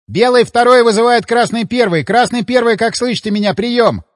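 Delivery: clean radio call, no static; clear radio voice. A narrator's reading. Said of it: "Заговорила рация неожиданно чисто, без всяких помех, голосом Олега".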